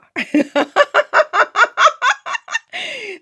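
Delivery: a high burst of laughter. Real, not acted. Amused by her own comeback, laughing high.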